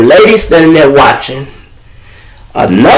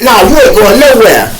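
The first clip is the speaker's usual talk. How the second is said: higher, louder flat refusal; blocking threat; 7 semitones higher, 4 dB louder than usual. Retold or imitated. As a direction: plant yourself in the doorway and refuse. Inmate blocking the door.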